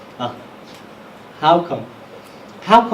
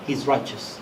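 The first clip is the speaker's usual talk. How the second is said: ordinary delivery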